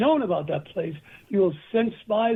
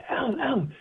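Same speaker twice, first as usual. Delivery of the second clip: put-on swooning girls; 4 semitones higher, 4 dB quieter than usual. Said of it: "American girls would corner me and say".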